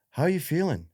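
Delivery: high-pitched mock bedside question; imitated chirpy doctor.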